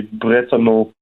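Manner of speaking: Bretonneux said the French way, silent X; French pronunciation. Shown as a demonstration. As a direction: say Bretonneux in French, ending on a vowel. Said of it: "How would I say that in French?".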